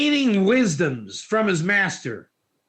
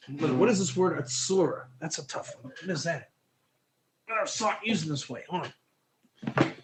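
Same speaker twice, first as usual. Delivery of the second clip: much softer, half-whispered muttering; muttering to self; 6 semitones lower, 9 dB quieter than usual. Real, not acted. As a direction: mumble it to yourself while searching. Muttering while looking up word.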